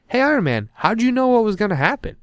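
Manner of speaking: high-pitched mock crowd calling out; questioning crowd voice. Everyone to Iron Man after the party, high-pitched puzzled call.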